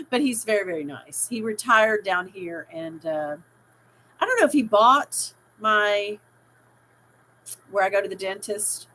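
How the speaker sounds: usual speaking voice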